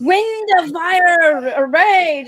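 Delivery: slow, booming heroic proclamation; dramatic superhero. Retold or imitated. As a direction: declaim it like a goddess. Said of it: "Storm's like".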